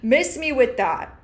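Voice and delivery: high, laughing sassy brush-off; sassy dismissal. Retold or imitated. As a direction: wave it off with sass. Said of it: "So I'm like".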